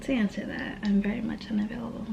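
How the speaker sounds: soft, fast whisper, voice gone; coy whisper. Real, not acted. Vlogger teasing viewers about being taken, whispered. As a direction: let them in on a secret.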